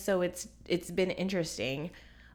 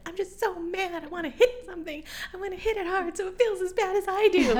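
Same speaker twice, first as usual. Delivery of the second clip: higher, strained mock fury; Sally Field's angry scene; 11 semitones higher, 3 dB louder than usual. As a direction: let the grief boil into wanting to hit. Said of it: "resonate with that part with the movie when she's like".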